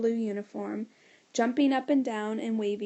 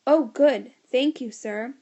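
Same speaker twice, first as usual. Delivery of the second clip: yelling; 7 dB louder than usual. A narrator's reading. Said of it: "George yelled even louder than before".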